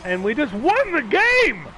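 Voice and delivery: high, loud, slow triumphant announcement; excited outburst. Real, not acted. Gamer breaks off joke as they win, thrilled. Game won mid-joke.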